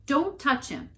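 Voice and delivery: high, loud bark of a warning. A narrator's reading. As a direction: snap it like a threat. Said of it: "barked at the wise men".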